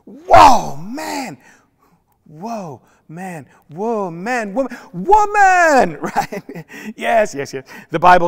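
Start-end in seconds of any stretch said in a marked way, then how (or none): 0.1-6.0 repeated Whoa man building to a loud high Woman!; Adam wowed, ending in a shout; amazed Adam. Acted. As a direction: let the awe tumble into the word woman. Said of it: "He's just going".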